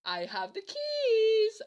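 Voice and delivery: high, slow, drawn-out girl imitation; smug girl. Girl explaining how she got back in, smug. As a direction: stretch it out loud and teasing.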